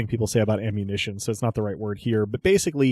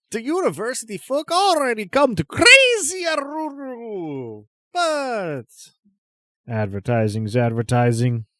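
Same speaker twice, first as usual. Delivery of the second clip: high, loud showman merchant; eccentric merchant voice; 12 semitones higher, 5 dB louder than usual. Acted. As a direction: sell it like a crazy trader. GM voices Crazy Aruru.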